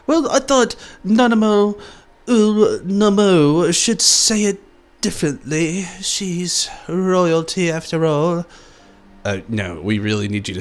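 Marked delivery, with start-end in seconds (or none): 0.1-8.4 high, slow, airy royal voice; cartoon princess. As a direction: play a prissy princess, slow. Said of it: "She's like".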